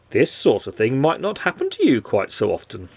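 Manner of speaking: ordinary delivery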